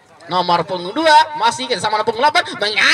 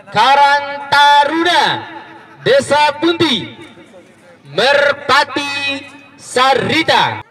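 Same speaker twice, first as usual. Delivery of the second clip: sponsor announcement; slower than usual. Acted. Reading out the sponsors.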